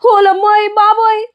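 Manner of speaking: high wail of pain; pained cry. Acted. Beaten boy wailing in pain after the kick, high cry. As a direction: cry out as the blow lands.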